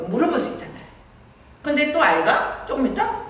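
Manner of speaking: ordinary talk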